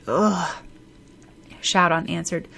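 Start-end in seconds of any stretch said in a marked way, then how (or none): 0.1-0.6 drawn-out disgusted "ugh"; groaning. A narrator's reading. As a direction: groan with exasperation.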